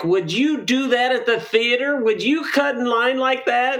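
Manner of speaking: high, indignant complaint; mock outrage at line cutters; exasperated. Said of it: "I'm like".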